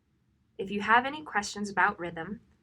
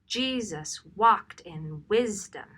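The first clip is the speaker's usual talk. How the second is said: slow and louder, "Jesus" and "walked" hit hard, "in" and "wisdom" light; stressed rhythm reading; 5 dB louder, slower than usual. Shown as a demonstration. Trainer models the first line's rhythm for translators, slow emphatic beat. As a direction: read "Jesus walked in wisdom" slowly, punching "Jes-" and "walked". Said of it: "The first line should have the following pattern".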